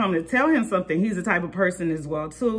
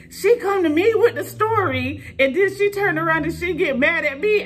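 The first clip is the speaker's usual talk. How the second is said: quoting dad; 10 semitones higher than usual. Retold or imitated. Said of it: "My dad was like".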